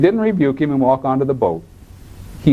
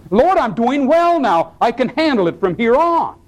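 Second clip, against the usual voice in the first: high-pitched, overconfident declaration to God; cocky self-assurance; 12 semitones higher than usual. Acted. Believer tells the Lord he can manage, cocky. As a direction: announce you can manage alone now.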